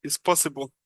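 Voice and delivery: normal talking voice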